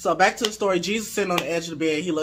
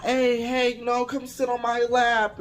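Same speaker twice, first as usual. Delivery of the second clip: raised-pitch imitation of a casual invitation; inviting host; 8 semitones higher than usual. Ronaldo calling guest onto his lap, inviting. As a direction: call them over in a higher retold voice.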